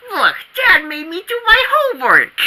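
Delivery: high whining grumble; whiny complaint. Acted. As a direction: whine it like a hard-done-by kid.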